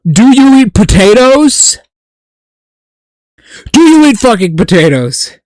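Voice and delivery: loud, hammed-up interrogation; mock furious demand. Streamer grilling chatter Nemesis about potatoes, mock furious. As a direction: bark the questions in fake outrage.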